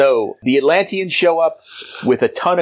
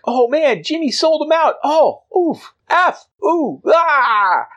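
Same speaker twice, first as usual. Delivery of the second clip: anguished cries. Acted. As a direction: wail in shock and grief. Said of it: "everybody's like".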